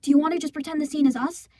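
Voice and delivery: ordinary talk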